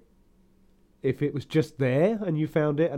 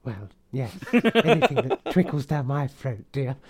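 camp innuendo voice; 3 dB louder than usual. Pretend. Camp banter continues.

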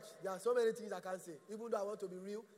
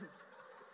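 real laugh; 17 dB quieter than usual. Real, not acted. Pastor laughs at own joke.

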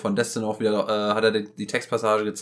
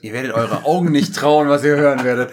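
clickbait video narrator; 3 semitones higher, 6 dB louder than usual. Acted. Hyping listeners like clickbait.

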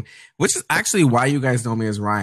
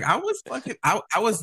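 high-pitched, stammering protest; flustered self-defence, pitched up; exasperated comeback; 11 semitones higher than usual. Retold or imitated. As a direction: stumble over it in a high, fed-up voice. Acting his exasperated reply to sister.

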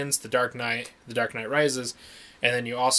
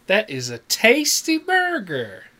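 movie quote voice; 8 semitones higher, 5 dB louder, slower than usual. Acted. Quoting a line from the movie.

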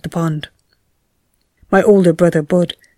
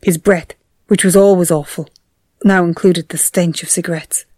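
ordinary talk, nothing marked

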